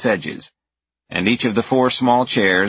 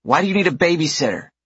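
put-on quick, high child question; curious little girl; 10 semitones higher, faster than usual. A narrator's reading. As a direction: fire it off like a nosy kid. Narrator voicing a curious girl.

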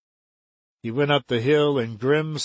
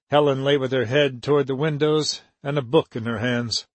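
plain reading voice

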